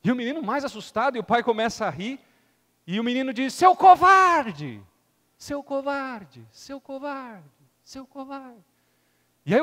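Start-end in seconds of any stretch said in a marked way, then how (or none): 3.5-4.8 high, loud, slow angry yell; angry shout. Acted. Scared boy insults the echo, slow angry yell. 5.4-8.6 higher, softer with each repeat; echo. Acted. The mountains throw the insult back, fading echo. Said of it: "o eco".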